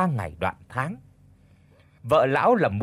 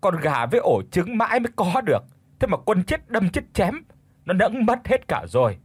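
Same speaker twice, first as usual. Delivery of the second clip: lamenting to heaven; 6 semitones higher than usual. Acted. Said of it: "mụ giơ hai tay lên than trời". (than trời, kêu gào to)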